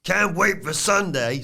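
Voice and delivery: high, slow, put-on impression; Stacks. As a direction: stretch it out high, impersonating him. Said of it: "read it in his voice".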